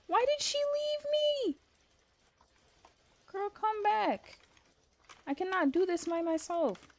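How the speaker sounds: mock heartbroken plea. Streamer hams up being abandoned by her teammate, high mock wail. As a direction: cry after her in a put-on whiny voice.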